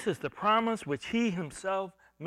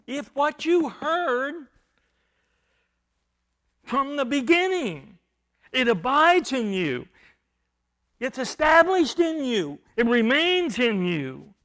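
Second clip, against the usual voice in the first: preaching emphasis; 4 semitones higher, 6 dB louder than usual. Real, not acted. Preacher building on the key words.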